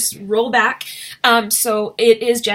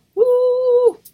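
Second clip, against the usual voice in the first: cheer; 14 semitones higher, 6 dB louder than usual. Real, not acted. Cheering about park closed for them.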